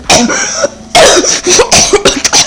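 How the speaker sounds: harsh high coughing; real coughing fit. Real, not acted. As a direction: cough hard, unable to stop. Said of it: "Sorry, I had the cough".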